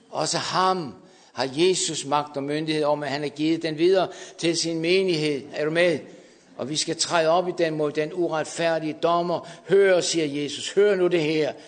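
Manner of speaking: loud, high shouting sermon; preaching at full force. Acted. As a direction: thunder it to the congregation.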